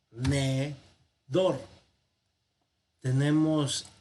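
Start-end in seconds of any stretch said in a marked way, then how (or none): 0.1-1.6 "tenedor" drawn out slowly as te, ne, dor; syllable by syllable. Shown as a demonstration. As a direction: slowly separate "tenedor" into three syllables, pausing between them. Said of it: "al separar sílabas". ("tenedor" silabeado lentamente: te, ne, dor)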